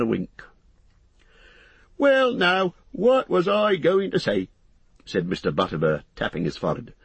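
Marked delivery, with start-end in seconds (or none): 2.0-4.5 high-pitched landlord losing his thread; muddled, busy innkeeper. Acted. Landlord trying to remember, flustered bustle. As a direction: puzzle aloud in a high character voice.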